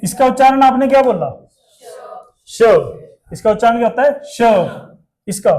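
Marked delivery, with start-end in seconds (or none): none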